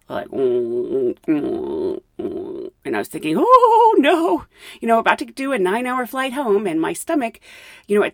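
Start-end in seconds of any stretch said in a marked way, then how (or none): 0.3-2.7 low imitated stomach rumbles; deep, drawn "mm" sounds of mock queasiness; queasy stomach groan. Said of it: "feeling in my stomach". 3.4-4.4 high, loud mock wail of dread; drawn-out, high-pitched comic alarm; dismayed oh no. Retold dread as stomach turns.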